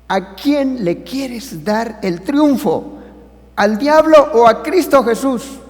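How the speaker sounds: rising to a high, loud, slow challenge; the pastor demands an answer, building. Retold or imitated. Pastor confronta al hombre. (pastor que exige una respuesta, cada vez más fuerte)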